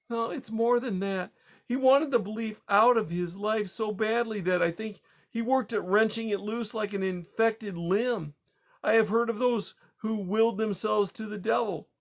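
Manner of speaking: high, hollow grief; grieving dread. Acted. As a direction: speak it as a dreadful realization. Widow fearing husband's damnation.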